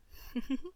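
high rising giggle; playful laugh. Acted. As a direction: laugh at your own joke. Laugh after song joke.

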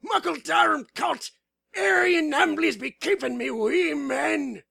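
high, loud, drawn-out Scottish yell; yelled Scottish war cry. Acted. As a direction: bellow it in a thick, barely intelligible Scottish accent. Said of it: "and yelled".

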